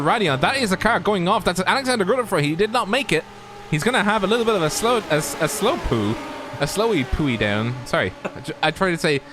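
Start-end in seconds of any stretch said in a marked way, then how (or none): none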